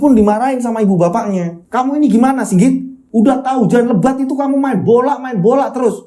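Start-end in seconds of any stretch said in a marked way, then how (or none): 1.7-6.0 higher, mildly scolding mother; omelan ibu. Acted. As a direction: nag him like a fed-up mother. (ibu yang mengomel ringan, suara lebih tinggi)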